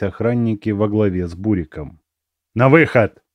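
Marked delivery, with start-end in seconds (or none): 2.5-3.2 sharp guard's bark; barked command. A narrator's reading. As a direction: bark the order like a warden. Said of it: "рявкнул тот".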